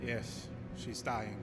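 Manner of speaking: low, soft, slow resignation; mourning voice over the bedside; doctor quietly confirming she is lost; grave. Acted. Doctor confirms death approaches.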